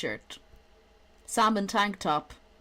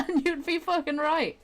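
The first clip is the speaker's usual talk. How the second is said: laughing; 9 semitones higher, 3 dB louder than usual. Real, not acted. Laughing at own joke.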